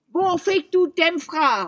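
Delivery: high, loud, slow troll bellow; troll shouting. A narrator's reading. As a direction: roar it out like an angry troll. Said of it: "råbte trolden".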